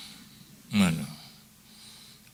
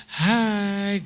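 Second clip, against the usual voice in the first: sweet greeting; 10 semitones higher than usual. Retold or imitated.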